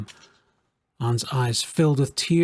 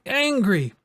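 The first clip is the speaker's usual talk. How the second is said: rising anger; 10 semitones higher than usual. A narrator's reading. Child venting his anger.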